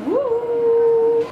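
high, loud whoop of excitement; excited whoop. Real, not acted. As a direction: let out a big nervous cheer. About to go into surgery.

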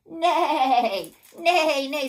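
loud, high drawn-out neigh; whinny the word out like a horse. A narrator's reading. Teacher showing children what a horsey says, loud neighing. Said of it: "What does a horsey say?".

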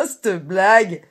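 high, loud cackle into an exclamation; shrieking laugh and scoffing shout; wild laughing cry. A narrator's reading. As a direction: burst out laughing madly, then cry out in disbelief. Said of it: "se mit à rire comme une folle et s'écria".

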